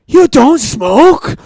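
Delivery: mock disbelief in an American accent; drawn-out American "You don't smoke?", amazed. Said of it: "transition between an American and Welsh accent".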